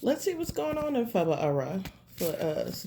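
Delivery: ordinary talk, nothing marked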